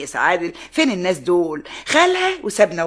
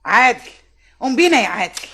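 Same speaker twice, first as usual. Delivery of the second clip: indignant order; 5 semitones higher, 4 dB louder than usual. Acted. Mother orders her son to leave.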